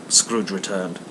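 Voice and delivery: ordinary delivery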